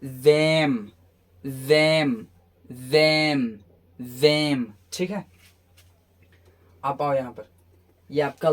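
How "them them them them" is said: "them" said as one drawn-out, louder word; model pronunciation. Shown as a demonstration. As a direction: model "them" slowly and firmly for learners. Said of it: "क्या हो जाएगा?". ("them" का साफ़, ज़ोरदार उच्चारण)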